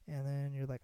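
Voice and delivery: normal talking voice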